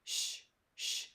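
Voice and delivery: unvoiced hushing sh; quiet shushing sh; whispered sh sound. Shown as a demonstration. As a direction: breathe out a soft shh. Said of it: "the middle S H sound as well".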